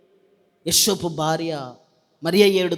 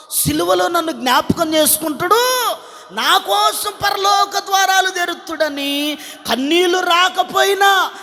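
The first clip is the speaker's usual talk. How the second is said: mock fake-weeping woman; 9 semitones higher than usual. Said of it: "woman's fake-crying inner thoughts".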